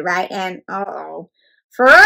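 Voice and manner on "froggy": loud, drawn-out call; teacher's cry. A narrator's reading. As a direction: call a daydreaming pupil to attention. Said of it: "cried his teacher".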